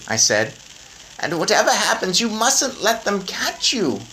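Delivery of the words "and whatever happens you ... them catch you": high, brisk grandmother voice. A narrator's reading. Grandmother planning with her grandson, high brisk voice. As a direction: plan it out like a determined granny.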